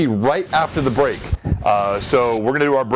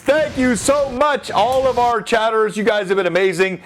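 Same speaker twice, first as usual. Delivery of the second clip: excited; 10 semitones higher than usual. Real, not acted. Thanking a sub gifter on stream.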